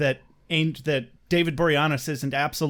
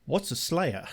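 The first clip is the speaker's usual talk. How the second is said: imitated Spike; 5 dB quieter than usual. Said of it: "And Spike is like".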